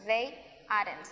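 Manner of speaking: "They aren't" said very slowly and clearly, low; slow, careful demo of "They aren't"; slow model. Shown as a demonstration. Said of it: "contraen el verbo y la negación".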